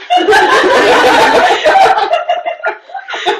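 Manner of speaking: very loud high unvoiced cackle; shrieking laugh. Real, not acted. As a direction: explode into helpless laughter. Shrubbery joke cracks up.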